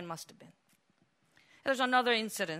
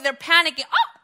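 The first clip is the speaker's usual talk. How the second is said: ordinary talk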